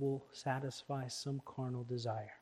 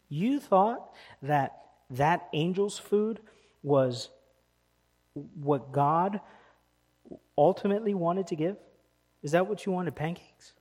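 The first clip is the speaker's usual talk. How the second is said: mocking challenge; 3 semitones higher, 8 dB louder than usual. Preacher mocks crowd's wish.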